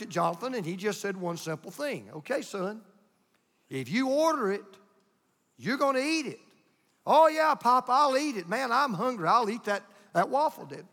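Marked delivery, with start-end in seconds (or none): 2.1-6.4 measured, higher-pitched grandfatherly warning; stern warning. Retold or imitated. Retold grandfather lays down the rule. 7.1-10.9 put-on high, excited kid bragging; eager boy. Retold boy eagerly insists he's hungry.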